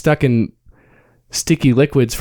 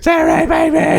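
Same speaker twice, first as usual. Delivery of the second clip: mock shout. Jokey shouted porn line.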